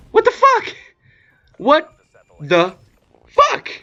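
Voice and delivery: very high, loud outraged yell, each word punched; outraged shout. Real, not acted. Storyteller outraged at his friend's betrayal, furious high shout. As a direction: shout it in disbelief, pausing between words.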